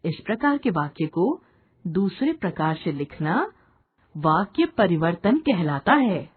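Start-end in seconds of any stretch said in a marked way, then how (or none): none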